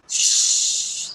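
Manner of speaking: long voiceless "shhh"; imitated snake hiss. Shown as a demonstration. Teacher shows children what a snake does, hissing. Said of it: "That is hiss".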